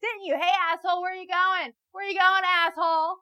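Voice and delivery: high, loud taunting shout; yelling at a creep on the street; mock harassing yell. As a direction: chase him down the street yelling. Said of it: "It's like".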